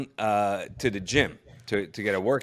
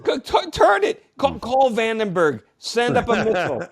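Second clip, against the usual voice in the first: higher, louder, mock-desperate orders through laughter; hammed-up desperate demand; 12 semitones higher, 5 dB louder than usual. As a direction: plead for the impossible, half laughing. Absurd demand to turn the station.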